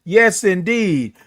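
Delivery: usual speaking voice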